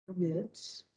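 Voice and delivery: ordinary talk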